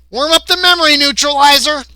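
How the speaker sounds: high, loud alien command; called-out order. Acted. Gray ordering the memory wipe, high loud call.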